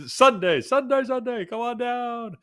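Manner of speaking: high hyped-up announcer voice; monster truck announcer. Pretend. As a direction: boom it like a radio ad. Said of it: "the classic lines".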